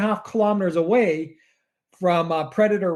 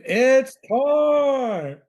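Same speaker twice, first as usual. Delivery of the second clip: high, drawn-out ring announcer call; Bruce Buffner; 8 semitones higher than usual. Acted. Co-host pitches a show intro, imitating an announcer's drawn-out call. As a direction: stretch it out like a fight-night announcer. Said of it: "We should get Bruce Buffner".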